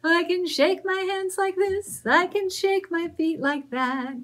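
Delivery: scarecrow chant, high and loud; mild shout at the start of the scarecrow rhyme. Acted. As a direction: call it out like a jolly scarecrow. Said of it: "he shouted very loud".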